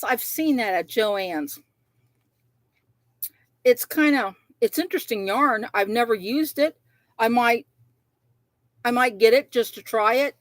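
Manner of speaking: ordinary talk, nothing marked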